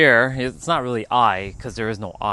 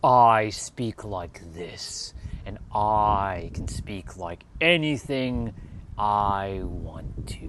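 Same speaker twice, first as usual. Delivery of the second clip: very slow; much slower than usual. Shown as a demonstration. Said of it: "This is my normal way of speaking".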